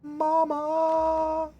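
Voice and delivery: shrill high yell; high shout. Acted. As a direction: belt it out high and loud. Sudden outburst in game.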